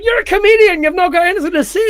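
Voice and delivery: high-pitched, quickening mock scolding; exasperated friend. Friend goading the comedian to speak, mock exasperation. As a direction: act out an incredulous prod.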